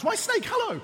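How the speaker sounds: high storybook mouse voice; mouse greeting. Acted. Mouse greeting Snake on the path, high-pitched. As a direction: sing out a high hello.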